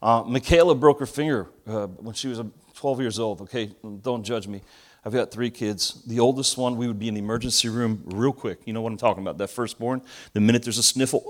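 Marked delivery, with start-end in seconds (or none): none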